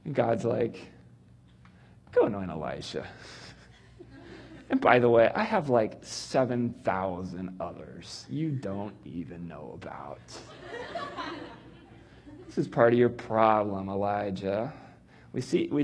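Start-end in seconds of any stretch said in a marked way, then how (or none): 2.1-10.2 lower, softer, unhurried reply; calm, low divine correction; God gently setting him straight. Acted. God answers Elijah.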